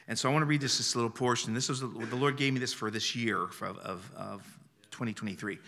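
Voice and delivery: usual speaking voice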